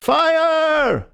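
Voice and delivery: long high shout all together; full shout. A narrator's reading. Everyone shouting fire together, full shout. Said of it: "all together".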